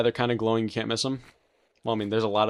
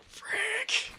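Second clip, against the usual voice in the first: startled squeak; 4 dB quieter than usual. Real, not acted. Drone appears, sudden curse.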